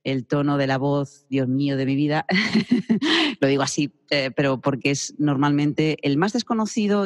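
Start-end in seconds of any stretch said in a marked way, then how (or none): 2.3-3.3 laugh. Real, not acted. Joking about the hardest topic.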